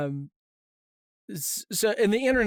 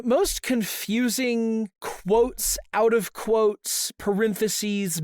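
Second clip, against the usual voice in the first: drawn out in disbelief; slowly, spelling out the mess; slow exasperated emphasis; 3 semitones higher, much slower than usual. Real, not acted.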